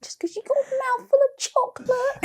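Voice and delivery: laughing cry. Pretend.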